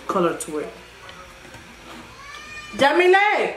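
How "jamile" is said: calling out. Real, not acted. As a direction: call the name across the house. Calling someone from another room.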